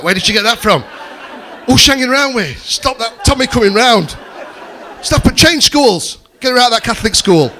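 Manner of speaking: loud, high mock outrage; outraged parent. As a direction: play a parent ranting in alarm. Acted outraged parent overreacting to swearing.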